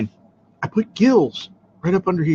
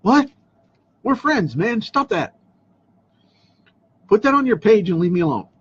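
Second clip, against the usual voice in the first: exasperated brush-off, higher pitch; annoyed; 6 semitones higher than usual. Retold or imitated. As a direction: tell them off, fed up. Annoyed reply to spammers.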